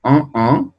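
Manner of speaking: en said as nasal ɑ̃. Shown as a demonstration. Teacher to class, isolating nasal en. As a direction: keep en in the nose. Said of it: "se pronuncian igual".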